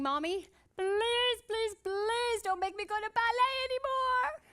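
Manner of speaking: put-on high, crying child's plea, long and drawn out; pleading little girl. Little girl tugging mom's skirt, crying and pleading. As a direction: beg like a tearful seven-year-old. Child begging mom to quit ballet.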